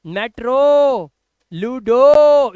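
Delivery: मेट्रो, लूडो with loud, long final 'oh'; stretched 'oh' ending on मेट्रो and लूडो. Shown as a demonstration. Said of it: "last में ओ है".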